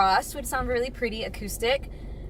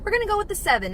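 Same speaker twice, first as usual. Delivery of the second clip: excited; 11 semitones higher, faster than usual. Real, not acted. Giving the food rating.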